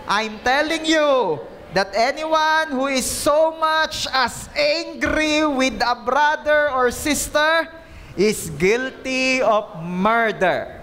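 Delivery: high, slow scripture reading; slow reading aloud. Shown as a demonstration. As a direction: read it out slowly with the congregation.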